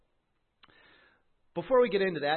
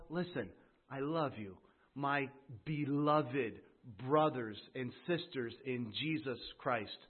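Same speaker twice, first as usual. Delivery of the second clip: tender appeal; 3 semitones lower, 5 dB quieter than usual. Shown as a demonstration. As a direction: speak it like a loving plea. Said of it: "sense the heart of James here".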